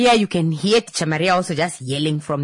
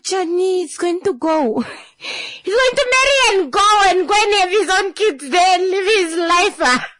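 high, loud mock wail; over-the-top tearful lament; wailing auntie; 12 semitones higher, 4 dB louder than usual. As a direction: cry it out like a grieving auntie. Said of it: "the auntie just starts crying".

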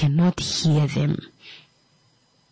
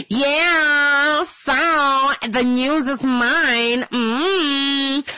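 higher, slow, gloating voice; smug mock glee; 7 semitones higher, much slower than usual. Mocking people who only welcome good news, slow gloating. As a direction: savour it like a greedy child.

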